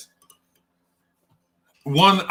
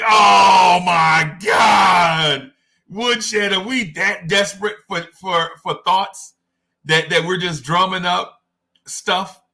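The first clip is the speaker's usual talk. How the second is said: exasperated disbelief; 4 semitones higher, slower than usual. Retold or imitated. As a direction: groan at the desperate pick. Reacting to the named player.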